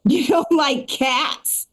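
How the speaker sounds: slow, drawn-out imitation of an indignant stranger; a hint of a mad lady's accusing tone, slow; mild mock anger. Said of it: "She's mad".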